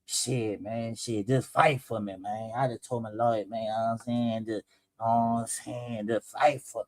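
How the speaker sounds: low quiet hopeless mumble; defeated plea. Retold or imitated. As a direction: barely say it, like a caught man begging. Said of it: "That's the voice I'm getting".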